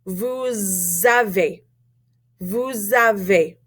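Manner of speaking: "Vous avez" slowly linked with a z sound; z liaison. Shown as a demonstration. Teacher modelling for repetition, "Vous avez" with slow z liaison. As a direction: draw out the z between "Vous" and "avez". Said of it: "do the same thing we did with nous".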